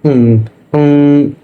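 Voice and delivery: long, lifted, wondering "Eng, eng"; long thinking eng. Shown as a demonstration. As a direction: stretch "Eng, eng" like you are puzzling it out. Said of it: "engnya panjang".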